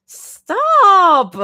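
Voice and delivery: high, loud flustered hiss; hissed protest. A narrator's reading. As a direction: hiss it out, embarrassed. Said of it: "Zoe hisses again".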